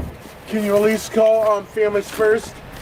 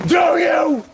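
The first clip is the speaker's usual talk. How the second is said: higher, furious yelling; angry shout; 7 semitones higher than usual. Real, not acted. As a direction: shout it at the cop. Drunk man yelling at officer.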